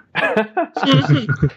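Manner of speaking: high short laugh. Real, not acted. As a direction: chuckle high and bright. Laughs at own joke.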